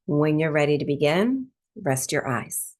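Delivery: calm, measured breathwork instruction; guided meditation. Real, not acted. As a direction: ease listeners into stillness, phrase by spacious phrase. Leads breathwork meditation after intro.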